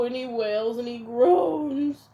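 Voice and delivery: ordinary delivery, nothing marked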